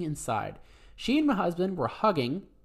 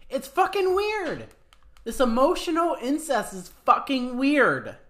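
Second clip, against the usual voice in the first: emphatic rant; 9 semitones higher, 5 dB louder than usual. Real, not acted. Ranting at the mother-in-law.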